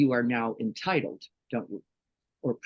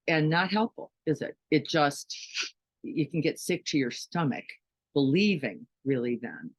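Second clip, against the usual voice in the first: usual speaking voice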